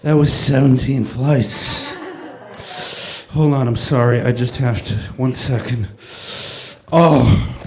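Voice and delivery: loud, gasping and panting after a climb; winded and laughing between gasps; out of breath. Acted. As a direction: heave each word out like you just ran seventeen flights of stairs. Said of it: "that's how tired I am".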